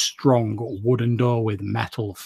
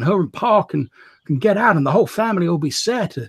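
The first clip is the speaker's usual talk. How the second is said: rising higher and faster, building to urgent; desperate pleading; 5 semitones higher than usual. Acted. Edgar explaining to his sister why he took the work, building desperate plea. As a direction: pour it out, justifying himself.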